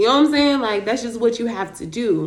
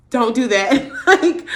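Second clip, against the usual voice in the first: laughing; 4 semitones higher, 6 dB louder, slower than usual. Real, not acted. Friend panics; speaker laughs it off.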